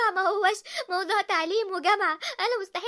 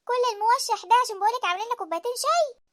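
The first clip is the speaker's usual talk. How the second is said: ordinary talk, nothing marked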